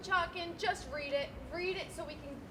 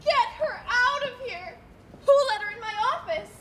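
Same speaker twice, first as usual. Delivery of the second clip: furious order; 7 semitones higher, 10 dB louder than usual. Acted. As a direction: bark the order at staff. Banker furious, ordering removal.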